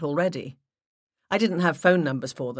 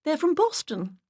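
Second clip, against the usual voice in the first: higher, breathless lady voice; high put-on flustered voice; 12 semitones higher than usual. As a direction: voice an octave up, stressing from. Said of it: "I raised my voice an octave".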